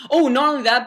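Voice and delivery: usual speaking voice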